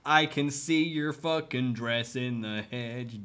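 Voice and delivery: slow, loud calling-out; mock taunt. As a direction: drag the words out to mock him. Taunting hidden creepy character.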